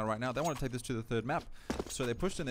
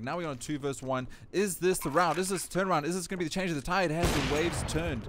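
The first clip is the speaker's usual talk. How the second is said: louder, higher esports caster; high-energy live commentary, kill after kill; excited play-by-play; 5 semitones higher, 7 dB louder than usual. Acted. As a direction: ride the firefight with rising urgency. Calls live esports firefight.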